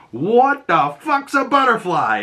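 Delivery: high, slow, baffled voice; baffled question. Retold or imitated. Peacemaker phoning Harcourt, high and slow, baffled. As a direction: drag it out, dumbfounded. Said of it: "And he asks her".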